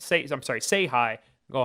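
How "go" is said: ordinary talk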